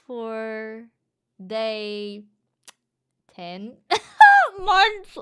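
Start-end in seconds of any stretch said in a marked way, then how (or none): 3.9-5.2 excited cry. Real, not acted. Reacting to a donation.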